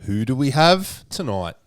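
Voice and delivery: drawn-out, punchy hype-man opening; show announcer intro. Acted. Host opens the show for listeners, hyped announcer voice. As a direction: announce the show with big stretched words.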